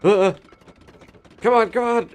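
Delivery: high loud frantic come on; panicked pleading. Real, not acted. Gamer panicking as something approaches, frantic high pleas. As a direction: beg the machine to start, frantic.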